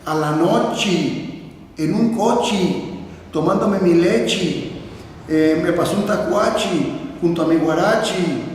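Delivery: final E turned to I: "nochi, cochi, lechi"; drawn-out old villager's "lechi" for "leche"; old-time final I. Retold or imitated. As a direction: stretch each phrase, ending "noche" and "coche" on an EE sound. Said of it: "no podían pronunciar la palabra E".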